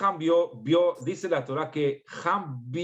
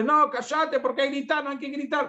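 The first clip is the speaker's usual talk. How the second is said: much higher, shouted-sounding scolding; mock yelling parent; 11 semitones higher than usual. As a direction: yell at the kid to stop yelling. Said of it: "Pues estoy gritando también". (padre que grita "cállate" al hijo, en tono mucho más agudo)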